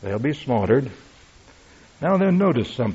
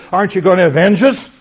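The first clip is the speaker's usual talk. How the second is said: high, loud, rushing protest; emotional plea; 8 semitones higher, 11 dB louder, faster than usual. Retold or imitated. Souls in heaven demanding God avenge them, high and fast. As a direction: fire it up fast and high, indignant. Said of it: "that's highly emotional".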